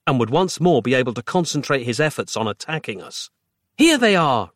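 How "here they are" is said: high, lifted announcement; exclaiming. A narrator's reading. Roz pulling out the right batteries, exclaiming. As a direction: call out the find. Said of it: "Roz exclaimed".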